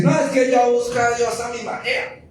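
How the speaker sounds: high, sing-song excuse; people making excuses. Retold or imitated. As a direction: mimic a protesting churchgoer on a high pitch. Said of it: "Y la gente dice".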